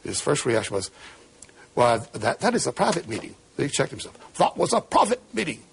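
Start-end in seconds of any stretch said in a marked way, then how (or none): 4.4-5.6 imitating a German accent, pitched higher; Kissinger's German accent. Retelling Kissinger catching himself, in a mock German accent. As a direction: put the German accent back on mid-sentence. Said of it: "assuming his German accent".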